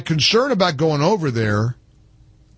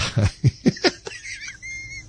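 laugh; 5 dB quieter than usual. Real, not acted. Host laughs at muffled caller.

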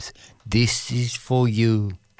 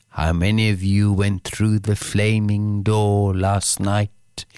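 ordinary talk

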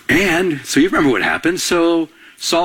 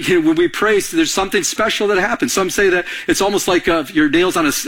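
normal talking voice

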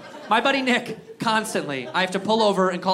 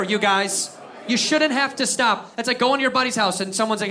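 ordinary talk, nothing marked

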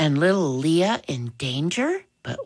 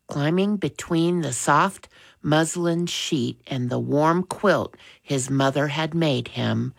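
usual speaking voice

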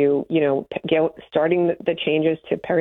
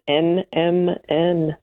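letters drawn out slowly; much slower than usual. Real, not acted. Guest repeating the supplement's name for the host, slowly. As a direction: give each letter its own beat. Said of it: "You have to say it slowly".

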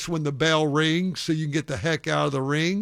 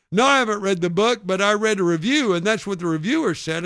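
fast, loud, higher mock retort; heated comeback at raised pitch and volume; retold yelling; 5 semitones higher, 6 dB louder, faster than usual. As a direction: imitate a heckler firing back defensively. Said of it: "he started yelling some other things".

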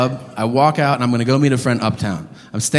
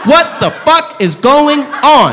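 incredulous rant; 8 semitones higher, slower than usual. Real, not acted. Ranting on toothless people.

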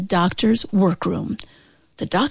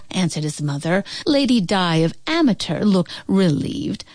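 plain reading voice

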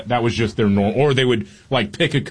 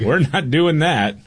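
mock parental refusal; 6 semitones higher than usual. Dad acting out refusal.

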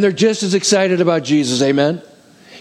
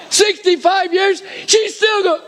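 laughing; slower than usual. Real, not acted. Laughing while calling the count.

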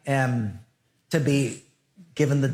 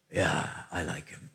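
low mumbling stoner; 10 semitones lower, 10 dB quieter than usual. Retold or imitated. Stoner preacher quoted in story.

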